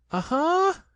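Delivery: high, loud triumphant aha; squeaky aha. A narrator's reading. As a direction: Piglet trying to sound bold. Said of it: "said Piglet again".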